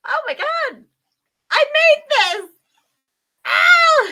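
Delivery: very high, loud exclaiming, ending in a scream; hammed-up shrieking wonder; overwhelmed amazement. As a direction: gush it loudly in disbelief. Re-enacting amazement at her newborn.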